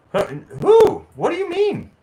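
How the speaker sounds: high, sudden "huh, who?"; baffled wife asking who he means; wife caught off guard, high and puzzled; confused surprise. Retold or imitated. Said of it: "she's like".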